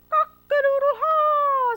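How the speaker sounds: very high, loud rooster crow; crowing laugh. Acted. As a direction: crow it out like dawn. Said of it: "said the rooster".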